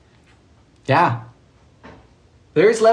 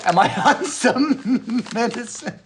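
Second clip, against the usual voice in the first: high, slowed words breaking into laughter; helplessly laughing question; real laughing; 7 semitones higher, slower than usual. Real, not acted. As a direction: try to speak through giggles. Cracking up while reading chat.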